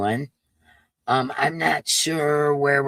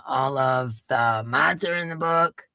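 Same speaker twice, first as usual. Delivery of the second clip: normal talking voice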